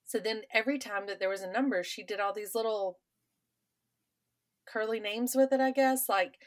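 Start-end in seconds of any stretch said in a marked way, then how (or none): none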